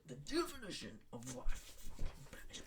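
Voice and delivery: unvoiced whisper of disbelief; whispered exasperation about British. Real, not acted. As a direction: hiss it under the breath. Whispered repeat of rant.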